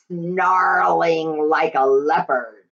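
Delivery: ordinary delivery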